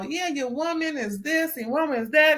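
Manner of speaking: normal talking voice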